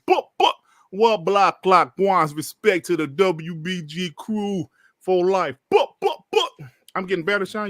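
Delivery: ordinary talk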